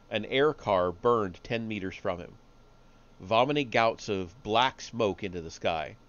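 ordinary delivery

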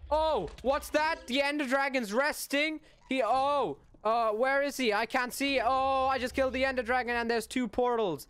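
high, loud hammed-up gasps of surprise; faked surprise. Gamer staging a fake dragon kill, hammed-up surprise. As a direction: overplay the shock for the camera. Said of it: "Need to make this look real".

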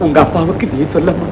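soft plea. Acted. Old man pleads with daughter.

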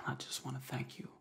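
hushed grateful whisper; quick soft thank-you whisper. Real, not acted. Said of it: "supposed to be whispering".

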